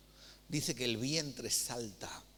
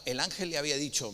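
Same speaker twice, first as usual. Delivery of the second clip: ordinary talk, nothing marked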